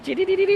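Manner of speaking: tiny high squeaks in a row; a wired, twitchy little squeal; high jittery coke noise. Retold or imitated. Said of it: "you go like".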